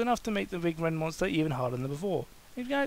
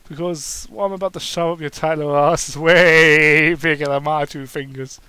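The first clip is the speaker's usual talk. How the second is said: louder, sly suggestive tone; 11 dB louder than usual. Acted. Kane teasing Daniel, suggestive statement. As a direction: say it with a leer. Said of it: "Kane stated suggestively".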